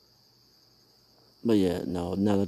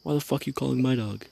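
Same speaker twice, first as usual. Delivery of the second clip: higher, indignant retold outburst; retold angry scolding; 5 semitones higher, 3 dB louder than usual. Telling off the other owner, retold indignation. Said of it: "I was like, I was like".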